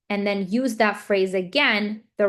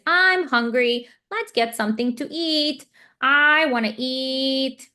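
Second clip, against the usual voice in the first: slow, higher, emphatic modeling voice; modeling the phrase; 4 semitones higher, slower than usual. Shown as a demonstration. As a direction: draw it out so a child can copy. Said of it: "I keep modeling the phrase".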